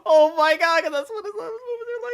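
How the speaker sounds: high-pitched, cracking-up speech; laughing too hard to talk; helpless laughter. Real, not acted. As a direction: squeeze the words out through hard laughter.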